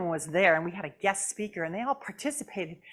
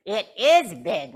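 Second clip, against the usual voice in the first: puppet voice; 9 dB louder, slower than usual. Acted. Puppet Jasmine.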